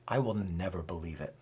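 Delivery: low, soft and stubborn, a defeated refusal. Shown as a demonstration. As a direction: drop the voice and close the door on it. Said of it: "I read that with a tone".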